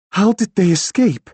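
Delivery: high, loud, urgent question; alarmed question. A narrator's reading. Woman learns that ghosts escaped, alarmed questioning.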